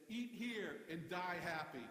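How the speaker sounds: slow, deliberate punchline slogan; billboard slogan. Shown as a demonstration. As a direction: deliver the sign slowly for the joke. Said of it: "the barbecue place right next door said".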